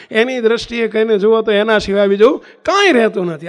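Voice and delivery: loud, driving sermon emphasis; forceful preaching. Real, not acted. Preacher driving home his message to devotees, loud. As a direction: press the point home loudly. Preacher stressing the key point.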